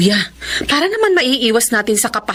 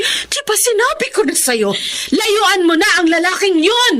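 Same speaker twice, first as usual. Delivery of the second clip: angry scolding; 7 semitones higher than usual. Acted. Mother orders daughter to leave boyfriend.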